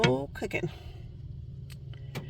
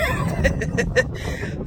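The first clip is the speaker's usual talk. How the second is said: higher, puffing laughter; winded laugh; 4 semitones higher, 4 dB louder than usual. Real, not acted. Hiker needing oxygen, winded laugh. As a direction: laugh through lack of air.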